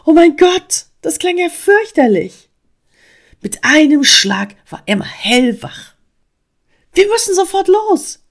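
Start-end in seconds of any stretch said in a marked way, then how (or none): 0.0-2.5 high, loud alarmed exclamation; erschrocken. A narrator's reading. Squirrel reacts to flood news. 6.9-8.2 high, loud urgent call; drängend. A narrator's reading. Frog urges flight from flood.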